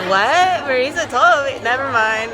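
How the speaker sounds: high, loud, surprised mock friend; quoting her friend. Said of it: "And she was just like".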